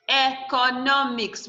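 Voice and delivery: "economics" slowly split into e, co, no, mics; syllable by syllable. Shown as a demonstration. Teacher counting four syllables for the class, "economics" slowly broken up. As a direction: sound out "economics" in four slow beats. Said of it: "We have four syllables here".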